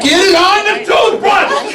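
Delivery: high, slow mock yell at the kids; hammed-up frustrated dad yelling; exasperated yell. Dad yelling at kids about toothpaste, high hammed-up yell. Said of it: "I'm like".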